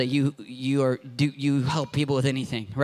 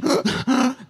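a sharp imitated gasp; mock gasp; 7 semitones higher, 4 dB louder than usual. Preacher mimicking the lady's asthma, a mock gasp. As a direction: act out the wheeze.

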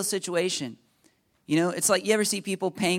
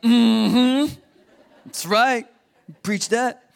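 loud, higher-pitched amen-corner woman; woman in the congregation; 5 semitones higher, 8 dB louder, much slower than usual. Retold or imitated. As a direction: mimic her emphatic, approving hum and cheer. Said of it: "some woman out there was like".

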